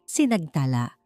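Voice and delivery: usual speaking voice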